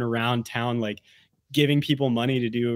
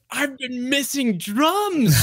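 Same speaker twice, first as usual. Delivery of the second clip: exaggerated quote; 11 semitones higher, slower than usual. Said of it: "I was like".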